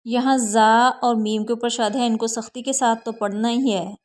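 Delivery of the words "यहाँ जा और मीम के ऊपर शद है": ordinary talk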